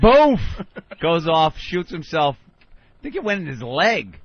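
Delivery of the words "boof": gunshot noise. Retold or imitated. Imitating the gunshot going off.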